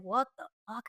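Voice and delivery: soft, retold stunned aside; hushed disbelief. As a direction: think it aloud, very quietly. Stunned inner reaction to big stranger.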